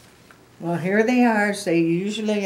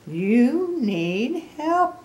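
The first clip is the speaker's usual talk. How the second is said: very slow, higher, pointed advice; Gerald; 6 semitones higher, 3 dB louder, much slower than usual. Acted. Gerald looks at Piggy and advises, slow and pointed. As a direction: stretch each word with emphasis.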